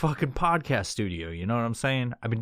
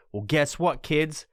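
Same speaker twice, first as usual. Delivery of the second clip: normal talking voice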